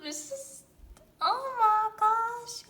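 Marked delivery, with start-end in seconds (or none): 1.2-2.7 high, loud, slow squeal; drawn-out squeal. Real, not acted. Fan overwhelmed by the photo, slow loud squeal. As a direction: stretch it out in a delighted squeal.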